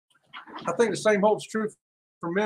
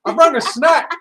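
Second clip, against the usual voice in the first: bright, louder announcement; excited; 7 semitones higher, 8 dB louder than usual. Real, not acted. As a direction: show off the snack eagerly.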